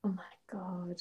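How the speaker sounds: soft unvoiced gasp; whispered; whispered shock. Real, not acted. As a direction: whisper it in disbelief. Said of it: "whispered shock".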